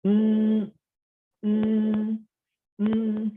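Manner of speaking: "mm" held high and even; flat tone. Shown as a demonstration. Teacher showing learners the first tone, flat tone. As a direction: hum "mm" on one high, level pitch. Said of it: "it's the flat tone".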